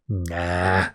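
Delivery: low, soft, offhand brush-off; retold deadpan refusal, dropped low and quiet; dismissive refusal. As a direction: brush it off under the argument. Acted dismissive refusal after rant.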